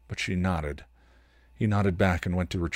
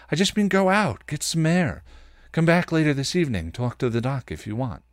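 a touch higher, quick backpedal; hastily walking it back; backpedaling Ben; 6 semitones higher than usual. Acted. Ben backpedaling to Buffy, a touch higher and hurried. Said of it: "He backpedaled".

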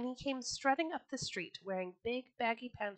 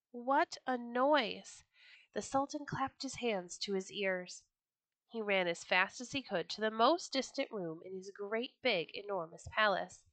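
usual speaking voice

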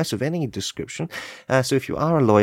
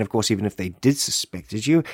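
ordinary talk

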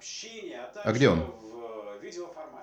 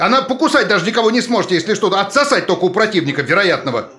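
higher, teasing jokey lift; mocking joke; 7 semitones higher than usual. Husband teasing about her mother to lift her mood, mocking. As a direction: make her laugh with a crude joke. Husband joking to cheer wife.

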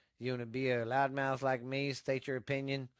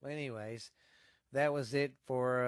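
ordinary talk

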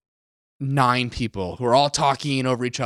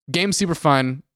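usual speaking voice

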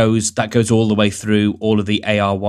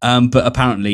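ordinary delivery

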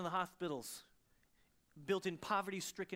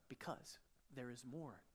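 soft, near-whispered emotion; preacher moved, hushed before prayer; hushed, emotional; 3 semitones lower, 12 dB quieter than usual. Real, not acted. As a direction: say it barely above a breath. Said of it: "I got so emotional there".

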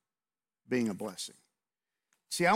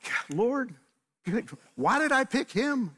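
exasperated laugh; 10 semitones higher than usual. Retold or imitated. Exasperated at hot car, sweating.

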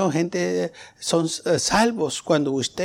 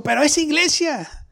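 shocked friend; 10 semitones higher than usual. Retold or imitated. Amigo escandalizado, citado.

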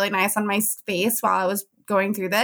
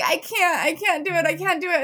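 higher, tearful mock pleading; mock desperate plea; 5 semitones higher than usual. Mother imitating herself in labor, mock desperate plea. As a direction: plead as if giving up, half-acted.

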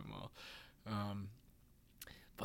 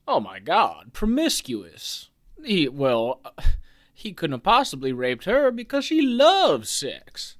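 shrill mock outrage, higher and louder; mock scandalized; 4 semitones higher, 8 dB louder than usual. Old-fashioned people judging the girl, mock scandalized. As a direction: play the gasping prude of the nineties, hammed up. Mocking old-time prudish reaction.

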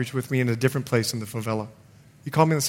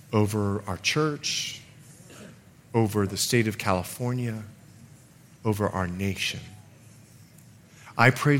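low, measured praying with long pauses, swelling midway then settling; earnest prayer; 5 semitones lower than usual. Real, not acted. Pastor praying for church and nation, earnest prayer. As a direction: pray aloud solemnly, phrase by phrase.

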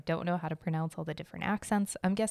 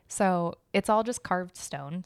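normal talking voice

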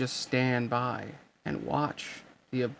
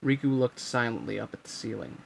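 plain reading voice

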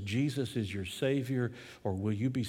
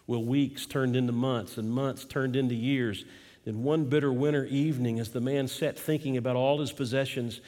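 ordinary talk

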